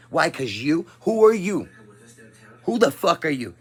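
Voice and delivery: real furious outburst; angry rant. Real, not acted. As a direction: yell it at the people who got offended. Ranting at offended viewers.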